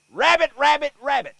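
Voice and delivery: high, loud hollered call. Shown as a demonstration. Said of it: "you should holler".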